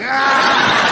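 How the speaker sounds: high, laughing battle yell; mock superhero charge yell; hero's battle cry. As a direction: launch yourself with a cry. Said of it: "He was like".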